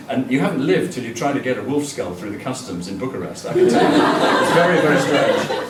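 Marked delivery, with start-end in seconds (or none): 3.4-5.6 laughing. Real, not acted. Customs in Bucharest anecdote.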